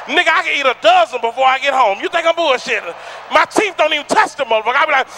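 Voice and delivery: ordinary talk, nothing marked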